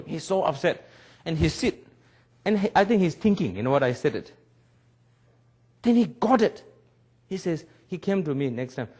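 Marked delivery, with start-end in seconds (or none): none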